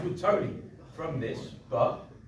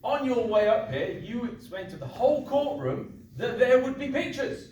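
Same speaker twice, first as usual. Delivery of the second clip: rising louder and higher in indignation; passionate argument; 8 semitones higher, 5 dB louder than usual. Acted. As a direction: build to a righteous rant. Judge escalating his defence.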